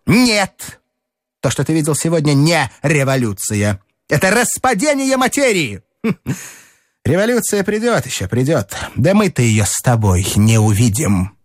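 high, feverish prophet of doom; frenzied Strukov. Acted. Strukov lectures Telegin on the coming chaos, feverish and high. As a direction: rave about chaos with wild excitement.

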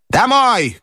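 high, sharp command; grandmother's cry. A narrator's reading. Grandmother ordering everyone home after losing, high cry. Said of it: "крикнула бабушка".

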